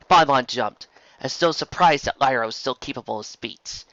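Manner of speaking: ordinary delivery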